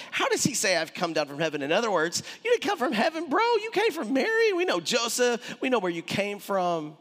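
high mocking voice of the doubters; scoffing crowd. Preacher voices the crowd mocking Jesus's origins, high scoff. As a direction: sneer at him like a know-it-all neighbour.

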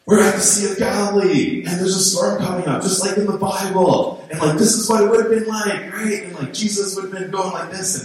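high-pitched excited storm reporter; imitating an overexcited amateur on camera; excited video guy. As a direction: call it out high over the storm, thrilled. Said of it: "there's this guy ... He's like".

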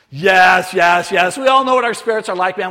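excited agreement. Retold or imitated. Villagers' excited agreement, acted.

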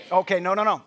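ordinary talk, nothing marked

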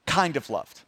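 low, understated and half-hearted; lukewarm. Shown as a demonstration. Pastor shows what God's love is not, lukewarm. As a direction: say it as if barely meaning it. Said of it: "it's not just that God".

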